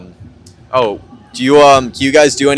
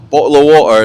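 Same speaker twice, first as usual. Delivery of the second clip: louder mock British catchphrase; mock British accent; 3 semitones higher, 5 dB louder than usual. Americans teasing English speakers about their accent, mock British. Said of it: "does everyone go up to you".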